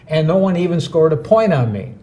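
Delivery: ordinary talk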